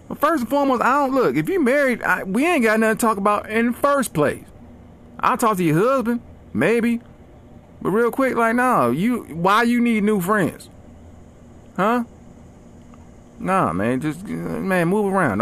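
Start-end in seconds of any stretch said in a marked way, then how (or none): 0.1-12.0 much higher, exasperated tirade; indignant rant. Real, not acted. Comedian refusing a married stranger's friend request, high indignant rant. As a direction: protest hard, voice up high.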